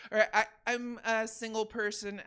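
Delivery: usual speaking voice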